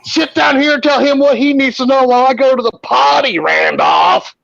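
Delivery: high, hollered orders; imitated bossy shout. Patient sits ex-husband at the desk, bossy mock shout. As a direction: order him about in the frantic woman's shrieky voice.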